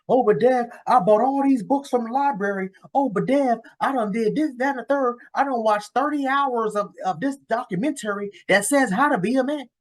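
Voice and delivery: high mocking whine of a son pleading with Dad; whiny kid voice. Mocking a son's protests.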